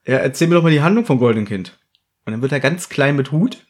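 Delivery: ordinary talk, nothing marked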